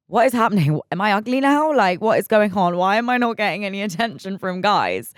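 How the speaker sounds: high, loud mock panic; a loud, high, hammed-up am-I-ugly freakout; shocked. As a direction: replay her baffled alarm at getting no attention. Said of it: "at first I was like".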